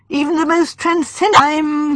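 high, exasperated splutter; words failing. A narrator's reading. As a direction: run out of words in mid-complaint. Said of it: "words failing him".

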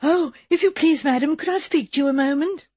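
a timid servant begging a moment; anxious young maid. Acted.

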